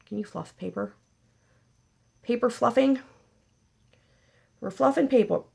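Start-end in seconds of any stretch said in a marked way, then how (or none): 2.2-5.4 higher, louder silly delight; playful exclaim. Pretend. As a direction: savor the silly phrase out loud. Playful tongue-twister bit.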